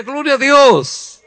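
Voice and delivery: loud praise cry. Shown as a demonstration. Preacher leads the congregation in a loud cry of praise. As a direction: lead the shout of praise.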